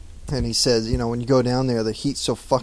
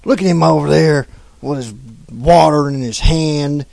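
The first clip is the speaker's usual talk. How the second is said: attempted Southern accent; 3 semitones higher, 7 dB louder than usual. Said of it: "southern accent".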